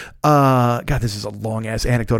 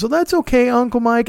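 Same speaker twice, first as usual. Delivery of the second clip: put-on much higher sweet young niece voice; niece reassuring; 11 semitones higher than usual. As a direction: soothe Uncle Mike, pitched way up. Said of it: "She goes".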